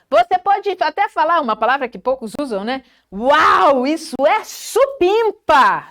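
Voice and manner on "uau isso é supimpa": forte e aguda, esticando "supimpa"; exclamação entusiasmada. Shown as a demonstration. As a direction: exclame maravilhado, com exagero. Exclamação de elogio encenada. (entusiasmo efusivo e brincalhão)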